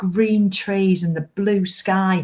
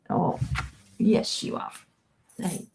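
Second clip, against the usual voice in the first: whispered pet talk, softer; cooing to the cat; 7 dB quieter, slower than usual. Real, not acted. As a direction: croon quietly to the kitten.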